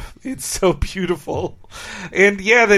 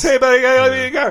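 rattled off very fast, slightly higher; rapid Homer quote; 6 semitones higher, much faster than usual. Retold or imitated. Podcast host quoting Homer's fast line, rapid-fire. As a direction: fire the whole name off in one fast breath. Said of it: "his name was".

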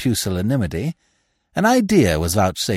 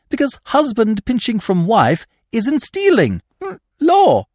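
put-on higher, eager young gentleman voice; 10 semitones higher than usual. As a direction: pitch the scheme like a keen young toff. Freddy voiced again.

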